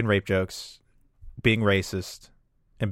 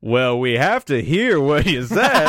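slow, higher show-host voice; imitated show host; 4 semitones higher, 4 dB louder, slower than usual. Host presses the comic to repeat it, mock insistence. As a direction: draw it out high like an insisting emcee. Host retold demanding to hear joke.

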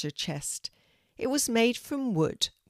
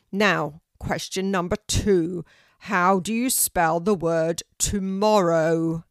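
booming tree teacher; 7 dB louder than usual. A narrator's reading. Oak tree asks the second question, booming. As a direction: boom the question out deliberately. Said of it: "said a deep, loud voice".

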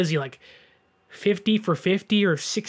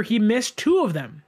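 ordinary talk

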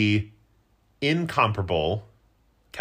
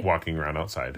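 deadpan refrain; 6 semitones lower, 4 dB quieter than usual. Real, not acted. Co-host's deadpan running gag.

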